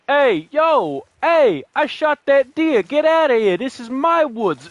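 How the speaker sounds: mock New York tough guy. New York hunter claiming his deer and woods, loud mock New York accent. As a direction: play a brash New York hunter guarding his turf. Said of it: "What I don't imagine is".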